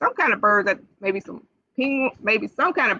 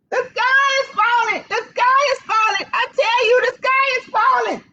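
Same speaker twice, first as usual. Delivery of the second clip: squeaky panicked chick; 11 semitones higher than usual. Acted. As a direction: squeak it out like a frightened little hen.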